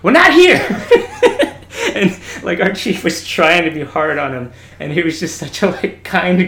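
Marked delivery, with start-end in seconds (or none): 0.0-0.6 high, loud, breaking into laughter; laughing chief yell. Retold or imitated. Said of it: "he's like". 0.7-1.9 high burst of laughter. Real, not acted. Laughing at own story.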